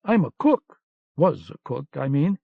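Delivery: a nervous little cook correcting himself; flustered protest. A narrator's reading. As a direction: blurt it out in a panic. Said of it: "Mouse protested".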